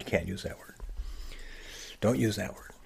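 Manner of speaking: a mock stern whisper of the taboo; whispered warning to a kid about a bad word; hushed scolding whisper. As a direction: whisper it like a strict grown-up hushing a child. Imitating adults scolding about bad words.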